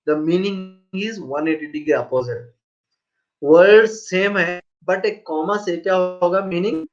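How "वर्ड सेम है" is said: normal talking voice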